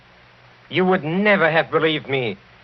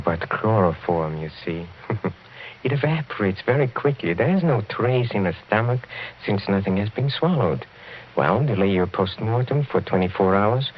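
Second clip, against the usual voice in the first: usual speaking voice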